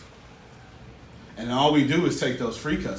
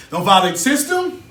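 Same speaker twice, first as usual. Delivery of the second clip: higher and louder on SYSTEM; emphatic warning; 10 semitones higher, 8 dB louder than usual. Shown as a demonstration. Coach repeating the rule to students, emphatic. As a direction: hit "system" hard, warning them.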